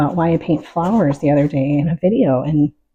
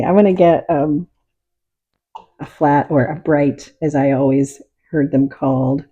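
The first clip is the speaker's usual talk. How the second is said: ordinary talk, nothing marked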